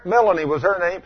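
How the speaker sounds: ordinary talk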